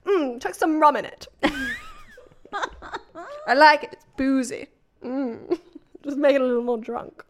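a hint of Prue Leith, laughing through it; Prue Leith impression. Reading to Neil in a mild Prue Leith posh accent. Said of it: "it's a little Prue Leith".

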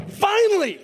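high, loud cry of relief; finally, at last!; relieved priest. Retold or imitated. Priest greeting the long-awaited angel, high and elated. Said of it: "wouldn't you think that as a priest you'd go".

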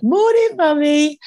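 very high, loud cutesy little voice; dog voicing good morning to her owner, very high and cute. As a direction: squeak it out like the dog greeting its owner. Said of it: "being like".

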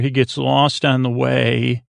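ordinary delivery

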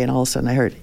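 ordinary talk

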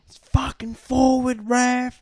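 high, drawn-out mock yell at the ref; imitated yell. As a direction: mimic an outraged player yelling, stretching the words. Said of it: "he's yelling at the ref".

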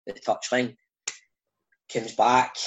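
ordinary talk

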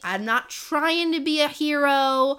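playfully exasperated, insistent protest; mock protest. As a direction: plead like a reluctant hero who wants no part. Acting out reluctant hero's protest.

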